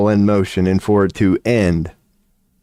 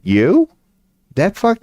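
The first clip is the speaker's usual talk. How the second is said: high incredulous squeak; high mock surprise; mock disbelief; 12 semitones higher than usual. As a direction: coworkers faking shock, voice jumping up. Coworkers' disbelief quoted.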